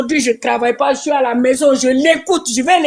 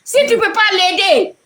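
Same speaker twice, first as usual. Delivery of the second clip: scolding; 5 semitones higher, slower than usual. Real, not acted. Scolding viewers to help.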